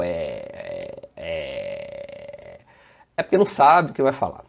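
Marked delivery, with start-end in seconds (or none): 0.0-2.6 low, soft, drawn-out "é", imitating a stalling speaker; hesitating filler. Instructor who doesn't know what to say, hesitating drone. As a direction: drone "é" slowly while searching for words. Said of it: "quando tá falando e começa o".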